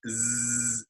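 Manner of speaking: long buzzing voiced "zzz". Shown as a demonstration. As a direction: hum the "zzz" with voice. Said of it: "If we produce a Z sound".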